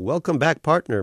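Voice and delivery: folksy welcome-back slogan voice; cheery slogan. A narrator's reading. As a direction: sell it like a poster slogan. Poster motif quoted.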